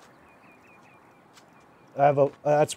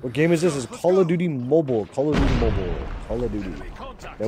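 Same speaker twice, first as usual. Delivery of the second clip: normal talking voice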